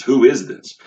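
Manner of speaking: punchy, loud query; questioning crowd. Acted. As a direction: call out the question like city folk. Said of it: "asking the questions".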